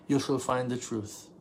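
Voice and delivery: usual speaking voice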